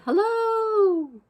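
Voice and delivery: a high, loud shout off a mountaintop; shouted hello. Acted. Child on a mountaintop shouting to hear the echo, loud high call. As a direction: call out big to the valley below. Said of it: "and I shouted".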